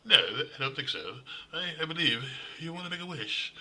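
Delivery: high, mocking British-guy voice; replacement actor's voice. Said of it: "But this guy, like".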